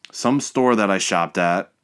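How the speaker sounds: ordinary delivery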